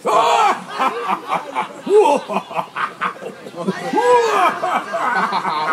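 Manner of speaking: pirate laugh. Pretend. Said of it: "savez-vous rire comme un pirate".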